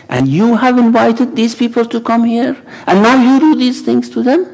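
indignant oration, rising steeply at the end; impassioned rebuke. Acted. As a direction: address an army, climbing to a high-pitched accusation. Acted rebuke to an army.

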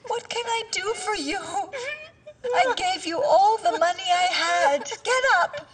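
crying plea. Acted. Begging the kneeling woman to get up, crying. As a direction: cry out while pleading with her. Distressed, pleading visitor up.

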